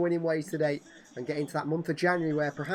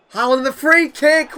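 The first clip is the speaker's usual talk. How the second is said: roaring the goal, higher and much louder; excited goal call; 10 semitones higher, 14 dB louder, slower than usual. Real, not acted. Gamer commentating his own goal, roaring it out. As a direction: shout the free kick goal like a commentator.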